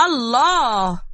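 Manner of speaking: drawn-out exclamation of delight; moved delight. A narrator's reading. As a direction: savour it with an appreciative "ahh" feeling. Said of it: "وهو مغمض عينيه بتأثر". (الله ممدودة بتأثر وإعجاب)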